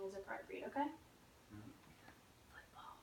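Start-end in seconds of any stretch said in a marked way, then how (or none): 2.5-2.9 whispered test word. Shown as a demonstration. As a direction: whisper it into the ear. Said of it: "I'm gonna whisper a word in your ear".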